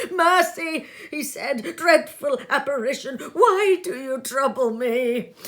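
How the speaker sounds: high, loud pleading cry; begging for mercy. Acted. Scrooge on his knees to the ghost, pleading loudly. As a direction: beg the ghost to spare you. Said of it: "Scrooge fell upon his knees".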